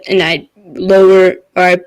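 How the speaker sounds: ordinary talk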